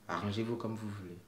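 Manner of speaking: quiet, resigned take-back; soft resigned repeat. Real, not acted. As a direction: drop the voice and shrug it off. Softened repeat of the aside.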